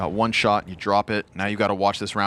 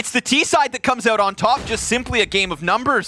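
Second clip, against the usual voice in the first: excited, raised-pitch caster call; live play-by-play; 11 semitones higher, 3 dB louder than usual. Real, not acted. Caster calls who comes out on top, excited play-by-play. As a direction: punch out the result of the fight.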